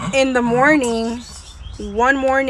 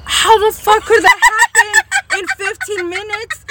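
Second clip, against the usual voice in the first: much higher, loud exasperated question; disbelieving; 9 semitones higher, 5 dB louder than usual. Retold or imitated. Daughter asking how dad got a pig, loud amused disbelief. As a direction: demand it in amused outrage.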